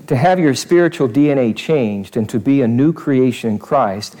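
usual speaking voice

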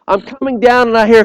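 ordinary delivery

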